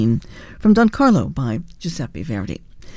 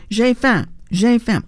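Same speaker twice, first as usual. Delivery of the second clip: mildly raised, higher-pitched imitation of yelling; mock megaphone yell; 4 semitones higher, slower than usual. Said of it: "she just yells through the megaphone".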